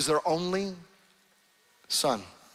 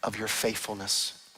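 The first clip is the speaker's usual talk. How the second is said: hushed sermon close; 4 semitones lower, 6 dB quieter than usual. Acted. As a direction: draw them in quietly, intimate. Preacher closes softly over music.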